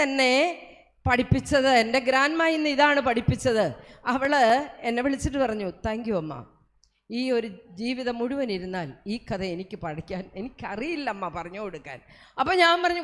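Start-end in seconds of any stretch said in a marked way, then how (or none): 5.9-12.0 lower, softer, fading to near silence; hushed heartfelt thanks from a grandma; a grandmother thanking her quietly, ever softer; soft grateful thanks. Retold or imitated. Grandma's grateful words retold.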